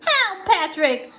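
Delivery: high, loud panicked call; crying out for help. Acted. SpongeBob runs to his friend's house, crying out in panic. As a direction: call out in cartoon alarm. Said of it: "SpongeBob cried".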